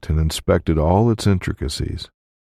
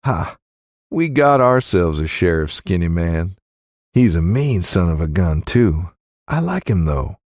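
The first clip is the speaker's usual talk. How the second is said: teasing innkeeper woman; 2 semitones higher than usual. Acted. Innkeeper talks about the sheriff.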